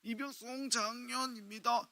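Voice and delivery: slow, high recruit's report; awkward new private reporting slowly; korean military style. Retold or imitated. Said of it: "입니다 하지 말라니까". (느리고 높은 신병 관등성명)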